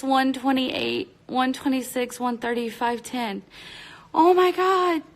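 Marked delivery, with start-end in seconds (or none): 4.1-5.0 high, drawn-in disbelief; stunned joy. Real, not acted. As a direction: exclaim it high, overwhelmed. Still stunned by the score.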